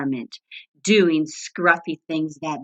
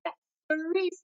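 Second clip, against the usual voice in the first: cheery bunny; 12 semitones higher, 8 dB quieter, faster than usual. A narrator's reading. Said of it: "said Buddy".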